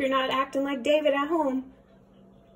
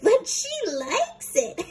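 high, louder, laughing protest; giggling kid; 11 semitones higher, 3 dB louder than usual. Acted. David protests about the cat, high and giggly.

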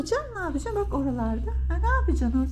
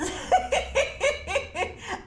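high giggling burst; laughing; 7 semitones higher than usual. Real, not acted. Owner laughs over being sixty, high giggle. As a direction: break into a run of high chuckles. Laughs while talking to the cats.

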